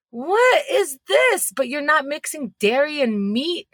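outraged disbelief. Acted. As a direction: stretch it out in shocked disbelief. Indignant rant at parents.

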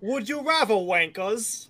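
put-on cheeky British accent taunt; mock British accent. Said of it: "I could do that accent all day".

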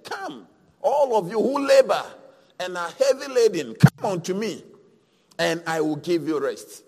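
loud, rising preacher cry; preaching. Acted. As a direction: call out like a preacher. Preaching at full force.

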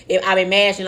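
ordinary talk

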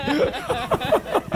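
high, real laugh. Real, not acted. Preacher joking that the group got spiritual, laughing. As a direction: burst out laughing.

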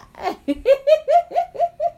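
loud, very high giggling; delighted giggle. Real, not acted. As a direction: burst into high, rapid laughter. Delighted at toddler's word.

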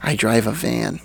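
usual speaking voice

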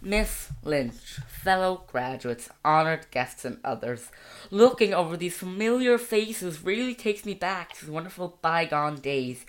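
put-on American valedictorian accent. Shown as a demonstration. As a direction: deliver a school-play speech in an American accent. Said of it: "that's an American accent right there".

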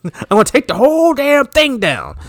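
high, overacted yell; hammed-up boss rant; hammy stage actor. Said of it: "he's just yelling it".

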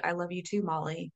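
soft, tender reply to oneself; gentle self-love. Retold or imitated. Turning the dog's love on herself, soft and tender. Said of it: "transfer that love to myself".

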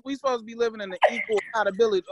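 ordinary delivery

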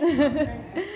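short shy laugh at herself; embarrassed laugh. Real, not acted. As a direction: giggle at her own mistake. Laughs at own Spanish.

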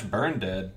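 "Burn Dead" as two plain English words; two-word name. Shown as a demonstration. Podcaster defending his reading of "Burn Dead", two-word version. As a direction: say "Burn Dead" with no middle vowel. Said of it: "I just read Burn Dead".

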